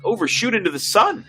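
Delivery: captain firm, officer alarmed; alarmed officer pushing back on a risky order; bridge officers arguing. Acted. Officer protests the jump to his captain, alarmed.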